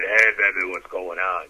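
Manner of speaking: mock deep seducer voice. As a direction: overdo a low, booming smooth come-on.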